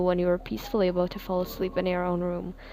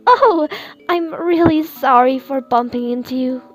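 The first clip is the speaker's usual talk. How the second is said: high squeaky burst, then rushed apology; squeaky flustered voice; 5 semitones higher, 5 dB louder than usual. A narrator's reading. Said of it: "you squeaked".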